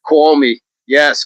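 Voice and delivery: ordinary talk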